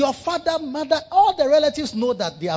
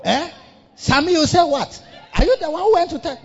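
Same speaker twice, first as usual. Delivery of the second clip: confrontational wife; 8 semitones higher, 3 dB louder than usual. Acted. Wife confronting husband, acted quarrel.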